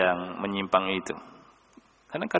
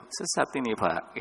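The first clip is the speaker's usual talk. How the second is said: softly telling him he is astray, much softer; gently; 8 dB quieter than usual. Shown as a demonstration. Preacher gently correcting the old man, soft and kind. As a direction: say "Sesat ini, Pak" kindly and quietly, like a caring teacher. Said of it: "Tapi dengan lembut saya bilangnya".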